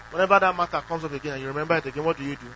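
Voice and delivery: ordinary talk, nothing marked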